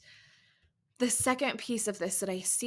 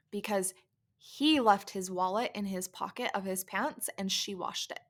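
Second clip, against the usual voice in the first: ordinary delivery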